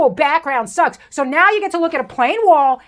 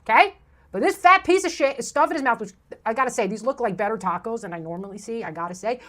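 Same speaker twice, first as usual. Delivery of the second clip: normal talking voice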